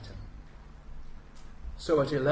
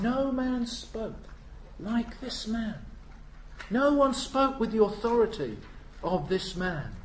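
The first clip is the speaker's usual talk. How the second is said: awed quote; 7 semitones higher than usual. Retold or imitated. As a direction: draw it out in wonder. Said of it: "Somebody said later on".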